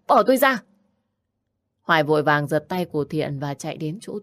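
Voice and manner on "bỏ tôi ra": raised, high-pitched protest; urgent demand. Acted. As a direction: demand to be let go, pitch jumping up. Grabbed woman demands release. (giọng cao, gắt đòi buông ra)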